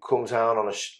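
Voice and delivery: ordinary talk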